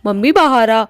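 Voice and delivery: loud, impatient yelling through a closed door; shouting at mom. Acted. Daughter yelling at her mother stuck in the toilet, loud and impatient. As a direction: bang on the door with your voice, calling her out.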